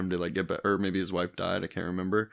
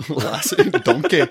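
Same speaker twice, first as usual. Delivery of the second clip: loud, higher hammed-up Scottish; Scottish accent; 6 semitones higher, 8 dB louder, slower than usual. Shown as a demonstration. Podcaster imitating from memory for a laughing co-host, loud Scottish accent. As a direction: belt out a playful Scottish imitation. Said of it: "Can you say anything else in a Scottish accent".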